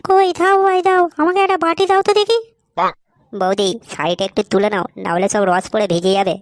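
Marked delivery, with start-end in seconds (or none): 0.1-2.4 very high-pitched scolding wife voice; exasperated wife. Acted. Wife tells husband they came to eat, exasperated. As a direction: scold him in a high, put-on voice. Wife snaps back, orders food.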